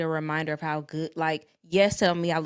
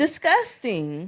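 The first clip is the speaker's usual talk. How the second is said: disgusted; 3 semitones higher, 3 dB louder, much slower than usual. Real, not acted. Disgust at the video.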